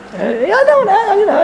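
high-pitched, hesitant imitation; mock excuse. Latecomer not wanting to wait around for a minyan, mock high excuse. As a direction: stall in a high mimicking voice.

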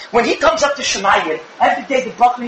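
ordinary delivery

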